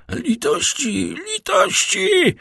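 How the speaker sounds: high, rising desperate plea, slow and drawn out; crying for mercy. A narrator's reading. Caderousse pinned under the count's foot, screaming for mercy. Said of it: "krzyczał Kaderus".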